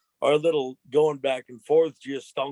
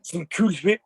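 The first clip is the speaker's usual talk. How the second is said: lightly voiced cartoon baby, pitched up; Stewie Griffin; 4 semitones higher, 3 dB louder than usual. Guest tries the same impression, higher cartoon voice. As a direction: raise the voice for a quick impression attempt. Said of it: "Stewie Griffin".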